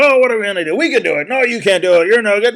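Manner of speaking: high, frantic animal voices; panicked forest animals. Acted. Forest animals crying out in the sudden dark, panicked. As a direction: squeal it out like scared little critters.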